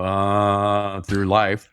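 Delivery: groaning zombie voice. Pretend. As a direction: drag it out like a mindless zombie. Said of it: "that's my zombie impression".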